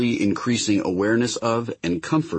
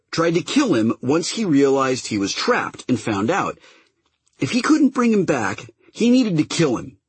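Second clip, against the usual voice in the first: exasperated captain; 10 semitones higher than usual. Acted. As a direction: pile the questions on, pitched way up. Kiva voiced ranting.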